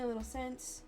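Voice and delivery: ordinary talk, nothing marked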